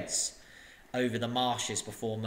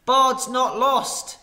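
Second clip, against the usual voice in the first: slow, loud, high cry of defiance; fierce cry; 11 semitones higher, 9 dB louder, much slower than usual. Acted. Bard, drenched, cries out to the crowd, slow and loud. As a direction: proclaim it fiercely, drawn out. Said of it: "he cried".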